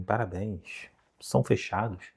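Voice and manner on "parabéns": very soft, flat "Parabéns" with no celebration; flat muted congratulations. Shown as a demonstration. Teacher mimicking a reserved region's flat congratulations, very soft. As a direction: say "Parabéns" barely above a murmur, reserved. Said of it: "elas falam parabéns meio que assim".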